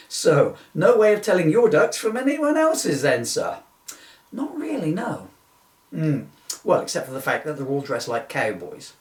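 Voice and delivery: usual speaking voice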